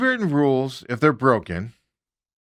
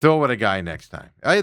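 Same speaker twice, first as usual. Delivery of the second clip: ordinary talk, nothing marked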